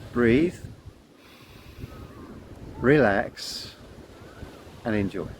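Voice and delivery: slow mantra, each word set apart by long pauses; calm mantra. Shown as a demonstration. Guiding children in a slow, calm mantra. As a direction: breathe it out slowly like a calming chant. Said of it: "the mantra I give to the children".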